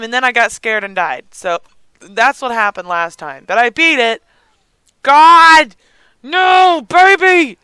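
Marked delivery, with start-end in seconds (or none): 5.0-7.6 high, loud cry of dismay; raised, frantic outburst; alarmed shout. Real, not acted. Alarmed at crying baby in game.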